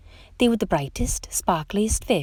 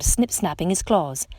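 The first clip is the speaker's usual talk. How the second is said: plain reading voice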